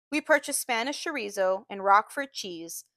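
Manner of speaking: ordinary delivery, nothing marked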